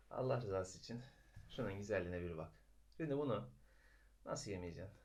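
very soft, held-back plea; hushed. Real, not acted. Morning vlogger pleading softly while Ezgi sleeps, hushed. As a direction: keep the plea low so nobody wakes. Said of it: "Çok bağırarak konuşamıyorum".

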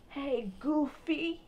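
slow, stretched-out "Hey, Goofy" with the "Goofy" drawn long; drawn-out greeting. Retold or imitated. Calling a friend Goofy in a slow, teasing drawl. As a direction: call him Goofy slowly and playfully. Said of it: "and I said".

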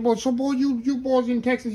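lightly voiced old Cajun chef; imitating the old chef. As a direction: imitate the old Louisiana chef teasing a Texas boy. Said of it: "He said".

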